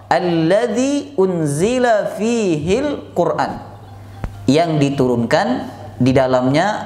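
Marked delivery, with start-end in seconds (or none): 0.0-3.7 slow, drawn-out chanted Arabic recitation; Quranic recitation. Shown as a demonstration. Preacher reciting the verse to the congregation, slow and chanted. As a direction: stretch each syllable like Quran recitation. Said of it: "kata Allah".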